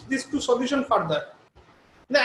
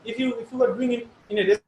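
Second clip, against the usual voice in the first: fading in through static. Real, not acted. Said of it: "your voice is fading in and out".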